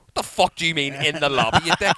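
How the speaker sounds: loud, high incredulous outburst; mock fury at a foiled plan; outraged. Dad reacting to the lobby announcement, outraged.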